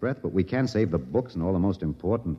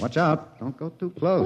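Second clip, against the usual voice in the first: urgent warning; 7 semitones higher than usual. Acted. Warning her near the snake.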